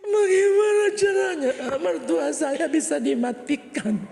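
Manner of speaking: high, loud begging voice; high pleading woman. Acted. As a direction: plead up high like a desperate wife. Wife asking doctor. (istri memohon ke dokter dengan suara tinggi)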